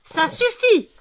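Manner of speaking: very high, very loud cry of enough; exasperated owner yelling at a barking dog; furious shout. Real, not acted. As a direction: bark out a command to stop.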